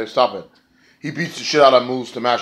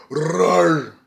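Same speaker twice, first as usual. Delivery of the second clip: higher, rolled growl; playful cougar roar; 5 semitones higher than usual. Pretend. Reviewer calls wrestler a cougar, playful roar. Imitates a cougar after naming one.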